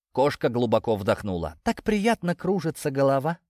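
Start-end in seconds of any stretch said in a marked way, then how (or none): 1.6-3.4 high, dreamy and tipsy; high giddy voice. A narrator's reading. Dizzy cat character.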